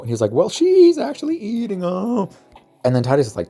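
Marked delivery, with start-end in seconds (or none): 0.3-2.3 high, drawn-out, louder reveal; mock gleeful reveal. Titus revealing the meal, mock high-pitched announcement. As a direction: stretch it out in a hammed-up high voice.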